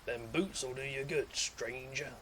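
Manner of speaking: a hint of the shopkeeper merchant; lightly voiced shady merchant pitching wares. Streamer trying the merchant voice for viewers. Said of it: "I can't do the merchant voice".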